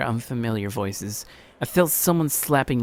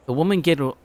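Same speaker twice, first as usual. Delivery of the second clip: ordinary delivery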